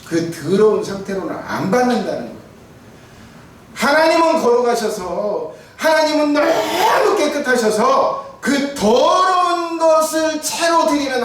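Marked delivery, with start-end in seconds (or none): 3.7-11.2 slow, loud, high preaching shout; fervent preaching. Acted. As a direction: proclaim God's holiness with force. Preaching at full force. (힘차게 외치는 설교)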